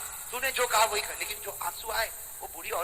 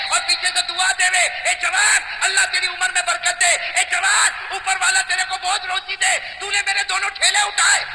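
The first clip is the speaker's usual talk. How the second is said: loud, high impassioned sermon voice; rousing storyteller preacher; fiery preaching; 9 semitones higher, 10 dB louder than usual. Acted. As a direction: preach it out to the crowd, ringing. Preaching the story at full force.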